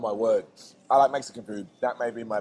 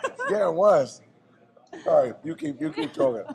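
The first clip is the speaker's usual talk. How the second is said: laughing. Real, not acted. Chili burn intensifying.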